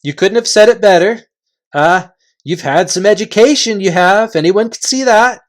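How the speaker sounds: cheerful flattery. Acted. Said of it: "said all the voices cheerfully".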